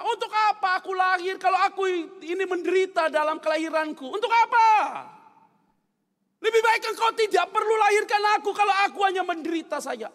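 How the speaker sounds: loud, high anguished protest; Ayub. Acted. Suffering man questioning God about his birth, loud anguished lament. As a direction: cry out to God in bitter complaint. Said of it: "Sehingga dia bilang sama Tuhan apa?".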